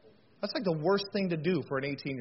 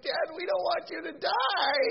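high, drawn-out mock wail; imitated crying daughters; 12 semitones higher than usual. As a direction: wail it slowly like an upset little girl. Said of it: "two of them are crying".

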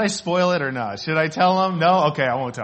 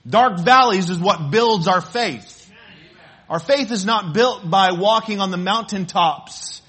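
louder, driving pulpit delivery; preaching; 2 semitones higher, 5 dB louder than usual. Acted. Pastor drives home that dark valleys build faith, preaching with force. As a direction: press the point home to the room.

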